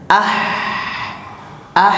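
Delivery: "Ah" breathed like a whisper; whispery ah. Shown as a demonstration. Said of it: "seperti orang berbisik". ("Ah" seperti berbisik)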